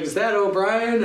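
high-pitched mock call, no real volume; retold shout of recognition; imitated yell. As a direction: call up the trail, held back. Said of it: "Matt Bertrand yells up".

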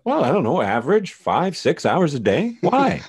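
high, loud comic defensiveness; mock defensive. Plays defensive man questioned about toilet time.

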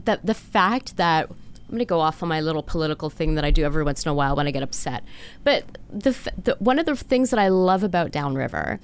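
ordinary delivery

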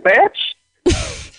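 distant speakerphone voice; phone held away. Real, not acted. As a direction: caller heard on speaker. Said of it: "first speakerphone".

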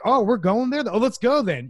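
mock bring-it-on. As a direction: imitate squaring up for a fight. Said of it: "in my mind, I'm like".

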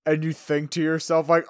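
ordinary delivery

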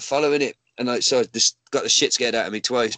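ordinary talk

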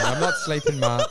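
laughing it off, pitch jumping up. Real, not acted. Laughing at hypomania question.

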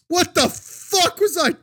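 high-pitched, drawn-out scream; mock horrified scream. Waking up after a shit-faced night, mock screaming. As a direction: re-enact waking up screaming in horror. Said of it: "Just screaming".

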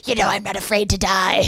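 squeaky little gremlin voice; lightly voiced gleeful gremlin. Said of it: "she does this little gremlin voice".

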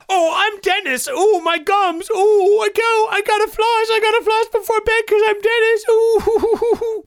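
high put-on dad voice; squeaky fussy Dennis voice; Dennis impression.

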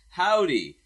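big high "Howdy"; loud mock greeting. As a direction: call it out loud and bright. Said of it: "And they all in unison said".

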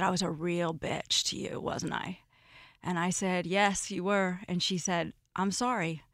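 soft, low reconciliation; subdued retold apology. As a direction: quote it gently, low and hushed.

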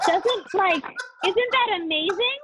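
gushing, high and delighted; swooning. Real, not acted. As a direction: gush over it like a crush. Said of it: "Are you swooning?".

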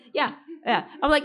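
ordinary delivery

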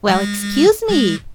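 slow, drawn-out sarcastic "Well, excuse me!". Acted. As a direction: needle someone who asked too much. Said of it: "Foi um excuse me sarcástico".

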